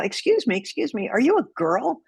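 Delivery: put-on an official demanding to know if she's a girl, accusing; accusatory. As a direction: challenge her like you've caught her out. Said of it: "he says it in such an accusatory manner".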